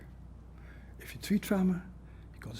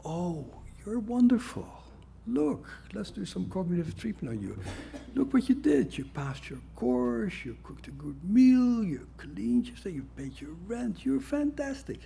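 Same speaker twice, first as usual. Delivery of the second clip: mock cheerful therapist; 7 semitones higher than usual. Therapist gushing praise at a patient, mocking. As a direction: gush like a do-gooder therapist. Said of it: "nice people like us start off saying".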